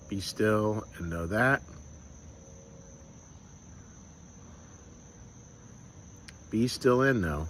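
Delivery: slow, soft meditation with long pauses; guided meditation. Acted. Pastor leading the congregation in stillness, slow and hushed. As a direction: let each shortened line fall away into silence.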